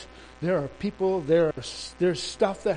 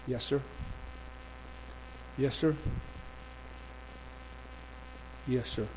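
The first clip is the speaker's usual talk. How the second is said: subdued acknowledgements with long gaps to listen; meek phone answers; 6 semitones higher, 15 dB quieter than usual. Retold or imitated. Immigration minister on phone to her superior, meek.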